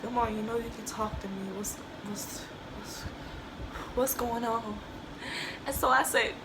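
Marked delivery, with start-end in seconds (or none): 0.0-4.8 very soft, hesitant coaxing; hushed, faltering parent voice; scared near-whisper. Retold or imitated. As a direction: imitate a worried mom gently prying, barely voiced. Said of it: "My mom was so scared".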